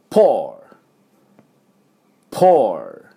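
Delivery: "pore" loud, with a popped P and falling tune; pronunciation demo. Shown as a demonstration. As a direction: punch out "pore", ending on English R. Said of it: "これちょっとやっておきますと". (「pore」のPを破裂させて強く)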